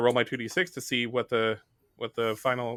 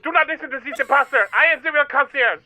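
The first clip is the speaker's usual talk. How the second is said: high, fast put-on pitch; hammed-up fast salesman concierge; 13 semitones higher, 4 dB louder, faster than usual. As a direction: rush to win the guests over. Competing concierge pleads, fast.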